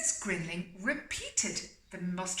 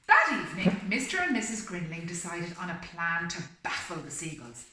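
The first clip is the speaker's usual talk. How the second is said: steady storybook reading voice; story narration; 7 dB louder than usual. A narrator's reading. Reading the story aloud to a listening pet, storybook voice. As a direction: read it like a bedtime picture book.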